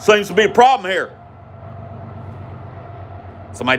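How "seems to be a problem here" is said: high-pitched confrontational dad voice; swaggering patriarch. Retold or imitated. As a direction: imitate a father swaggering up to trouble. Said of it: "swaggers up".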